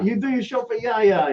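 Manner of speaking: lightly put-on Greek owner voice; mock Greek boss. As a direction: ham up the boss talking about Yaya. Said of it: "And do".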